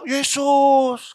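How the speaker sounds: drawn-out but soft mock wail; half-hearted pretend cry; imitated cry for help. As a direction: call out in trouble, softly stretched. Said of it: "saudara teriaknya apa".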